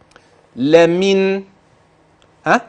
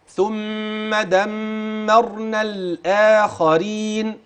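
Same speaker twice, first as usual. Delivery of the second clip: slower measured chanting of the verse; Quran recitation; 3 semitones higher, 3 dB quieter, much slower than usual. Acted. Teacher reciting the verse for the student, measured recitation. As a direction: recite it calmly, letting each word ring.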